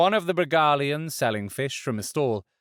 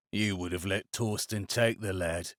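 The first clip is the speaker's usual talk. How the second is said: lightly voiced low, softer musing; Varlo, quiet; 4 semitones lower, 7 dB quieter than usual. A narrator's reading. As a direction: let it trail off. Varlo reproaches Kalidra.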